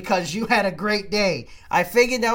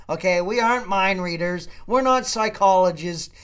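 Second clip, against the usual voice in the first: normal talking voice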